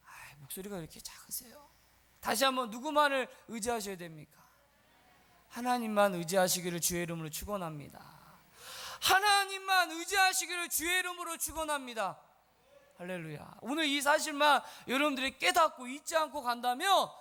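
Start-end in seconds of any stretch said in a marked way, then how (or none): 0.1-1.7 soft, low, half-whispered tease; playful near-whisper; hushed chiding. Real, not acted. Teasing congregation for weak reply. 5.5-8.2 solemn blessing, pitched low; restrained, low-pitched benediction. Real, not acted. First blessing, low before raised repeat. 9.0-12.2 high, ringing pulpit blessing; impassioned, high-pitched benediction; forceful blessing. Real, not acted. Same blessing repeated at full force.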